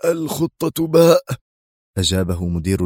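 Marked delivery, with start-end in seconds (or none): 0.0-1.2 "الخطة باء" high, drawn out in disbelief; indignant exclamation. A narrator's reading. Outraged security chief echoes his superior, indignant disbelief. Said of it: "وهو يهتف مستنكرا".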